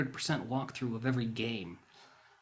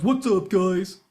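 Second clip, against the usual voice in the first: loud, high-pitched parody intro; mock YouTuber greeting; 7 semitones higher, 10 dB louder, slower than usual. Vlogger mocks other YouTubers' intros, mock YouTuber greeting. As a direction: imitate an overeager video host.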